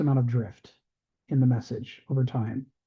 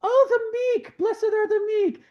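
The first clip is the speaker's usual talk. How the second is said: very high, slow falsetto woman; Python-style falsetto lady finally catching a phrase; woman in the crowd; slower than usual. Retold or imitated. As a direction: squeak it out like a delighted old lady at the back. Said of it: "then a woman goes".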